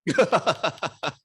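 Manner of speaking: high, loud burst of laughter; real rapid-fire giggling laugh. Real, not acted. Laughing about the game.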